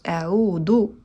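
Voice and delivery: "A'udhu" said with a hard d, tongue kept in; wrong dal. Shown as a demonstration. Teacher demonstrating the common mistake in "A'udhu". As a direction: say "A'udhu" as "audu" with a plain d. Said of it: "du, es incorrecta".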